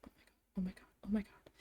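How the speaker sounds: breathless voiceless whisper, barely audible; retold frantic whispered oh my God; panicked whisper. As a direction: whisper it to yourself in shock. Panicking, almost crying at work.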